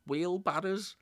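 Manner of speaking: sudden high-pitched reply, deadpan punchline; punchline answer. Retold or imitated. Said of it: "And the guy says".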